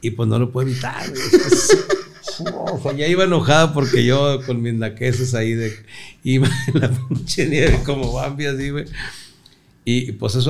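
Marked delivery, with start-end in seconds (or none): 1.3-2.0 breathy high-pitched giggle burst; high wheezing laugh. Real, not acted. Husband remembering wife slipping like Bambi, wheezing laugh. 2.7-8.9 words through laughter; laughing while talking. Real, not acted. Husband telling how his wife slid in the snow, laughing through words.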